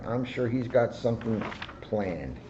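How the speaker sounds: ordinary delivery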